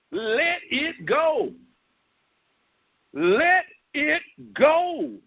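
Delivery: very slow, drawn-out plea; slow urging. Acted. Preacher pleading with the congregation, very slow. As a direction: stretch each word out gently.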